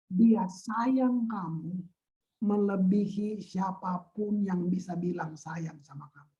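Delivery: very slow, earnest reassurance; tender assurance. Real, not acted. Preacher telling listeners they are loved, tender. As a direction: let each word sink in slowly and gently.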